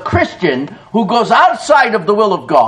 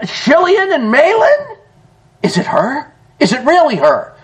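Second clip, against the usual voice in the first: amazed questioning; 6 semitones higher than usual. Acted. Townspeople marvel at Naomi.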